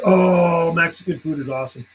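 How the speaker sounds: ordinary talk, nothing marked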